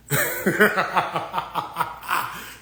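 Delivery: high-pitched burst of laughter; long laugh, falling away; real laugh. Real, not acted. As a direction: laugh at your own joke. Laughs at own short joke.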